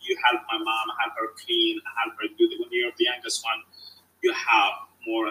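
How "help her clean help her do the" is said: ordinary talk, nothing marked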